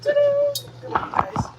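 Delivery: high showy ta-da; excited reveal. Real, not acted. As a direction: present it like a magic trick. Showing off the cut patty.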